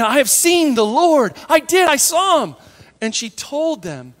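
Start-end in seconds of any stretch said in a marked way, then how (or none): 0.0-2.6 high, loud, breathless-with-news burst; acted elated proclamation, pitched up; excited announcement. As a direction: burst in insisting on incredible news.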